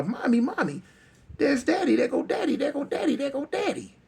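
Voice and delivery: put-on high, urgent child's cry for Mommy; hollering little girl. Little girl calling her mother about Daddy, hollering. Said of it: "She like, she holler".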